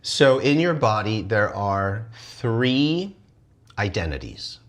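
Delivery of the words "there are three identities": dramatic slowing. Real, not acted. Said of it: "slow dramatic emphasis".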